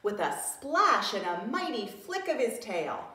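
usual speaking voice

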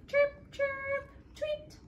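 bird chirps. Acted. Parent voicing Honey's bird sounds for the child, high chirping. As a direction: chirp it like a little bird for the toddler.

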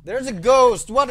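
sudden loud high fright; real jump-scare yelp; startled scream. Real, not acted. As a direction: shriek it out at the ghost. Jump scare in horror game.

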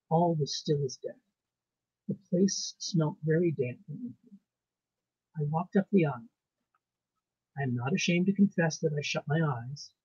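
ordinary delivery, nothing marked

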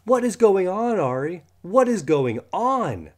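loud, high, frantic demand; upset shout. Acted. Boy shouting at friend about hair, alarmed.

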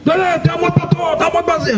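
high, strained drill-sergeant holler; imitated yelling. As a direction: yell the order in a mock sergeant's voice. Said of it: "למה הבן אדם צועק".

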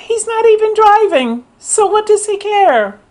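high incredulous outburst; can you believe it, way up high. Real, not acted. Telling the viewers, an incredulous high outburst. Outraged aside.

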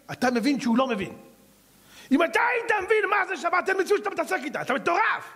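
the preacher exhorts the congregation in high, fiery tones; full-force preaching. Acted. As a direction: preach it with rising fire.

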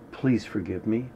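soft, pleading please forgive me; heartfelt plea. Shown as a demonstration. Teacher showing the real prayer, softly pleading. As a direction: ask forgiveness gently and sincerely. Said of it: "But, but you do it like this".

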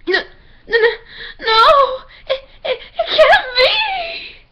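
very high, slow, crying wail; anguished denial. Acted. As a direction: wail it out in despair. Devastated denial.

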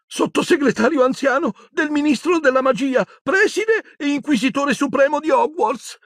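high, scared official voice; shrill terrified Umbridge. Acted. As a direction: squeak it out in fear, holding her dignity. Umbridge character voice.

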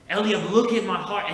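loud, high, commanding roar; roaring like a lion. Retold or imitated. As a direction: bellow it like a lion. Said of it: "he roars like a lion".

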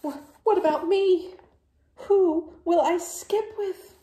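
high, loud, whining lament; dramatic wail. Acted. Gerald laments to Piggy, high sing-song wail. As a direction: wail it like a heartbroken friend. Gerald wailing, sung at end.

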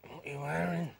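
low, growly come-on line; growling voice. Acted. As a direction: growl it out like a would-be seducer. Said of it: "what's up with this growling voice".

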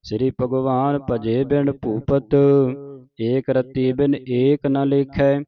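measured chant-like verse reading; sustained teaching recitation; scripture recitation. Shown as a demonstration. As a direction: recite in phrases, holding the long vowels.

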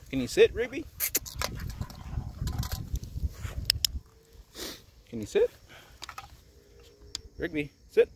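high coaxing voice to a dog; talking to a dog. Real, not acted. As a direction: coax the dog to sit, high. Asking dog to sit, high.

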